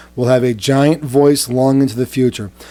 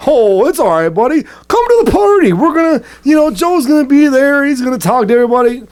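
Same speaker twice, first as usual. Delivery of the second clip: put-on much higher, chirpy kids' TV voice; children's show mascot; 10 semitones higher than usual. Host coaxing sulking Trump to the party, children's mascot voice. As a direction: coax him like a cartoon mascot. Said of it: "Why do you sound like a children's show, like, mascot".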